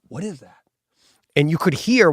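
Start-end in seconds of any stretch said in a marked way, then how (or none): 0.1-0.6 a far softer, hushed "What is that?"; a horror-movie character whispering at a noise; hushed question. Retold or imitated. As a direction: breathe the words as if afraid to be heard.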